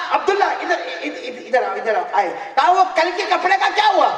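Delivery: high, loud calling voice with a laugh; animated calling out. Acted. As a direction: call him over cheerfully, full voice. Preacher voicing Prophet calling Abdullah. (ऊँची, ज़ोरदार, हँसती हुई पुकार)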